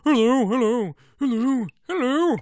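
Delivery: high, loud test hellos; trying out voices. Shown as a demonstration. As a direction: audition silly character greetings, loudly. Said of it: "what voice am I gonna give this one".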